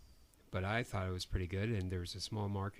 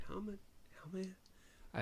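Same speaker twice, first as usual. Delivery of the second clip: retold baffled murmur; 7 semitones higher, 13 dB quieter than usual. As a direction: whisper it like a stunned self-question. Retelling his baffled reaction to insult.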